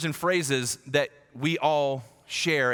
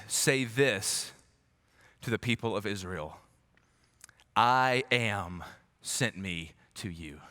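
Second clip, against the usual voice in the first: deep solemn God voice; 5 semitones lower, slower than usual. Retold or imitated. God speaks to Moses.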